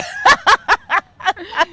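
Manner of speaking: high, loud burst of laughter; laughing. Real, not acted. Laughs at own remark.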